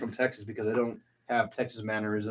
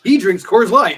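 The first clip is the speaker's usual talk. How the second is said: loud, higher-pitched accusation; mock outrage; 6 semitones higher, 10 dB louder, slower than usual. Co-host exposing the host's beer secret, mock outrage. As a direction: expose them with playful horror.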